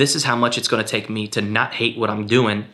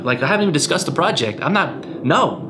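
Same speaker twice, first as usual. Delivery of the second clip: higher, firm mock refusal; flat refusal; 7 semitones higher than usual. Retelling turning down a pushy stranger, emphatic mock refusal. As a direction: shut the stranger down, clearly pitched up. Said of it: "And I'm like".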